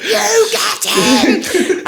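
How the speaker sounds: put-on high, loud, stretched child's yell; excited kid cheering. Little boy cheering the armoured fighters, in a high drawn-out yell. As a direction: shout like a small kid egging on a fighter.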